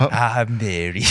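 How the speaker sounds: slow, drawn-out mock character line; Mary. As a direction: stretch it out as a lightly imitated game character. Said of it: "Is it Mary?".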